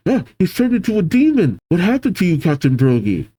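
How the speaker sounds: high, hurried dismay easing down; shocked outcry. Acted. Giants to demonized captain, acted shocked cry.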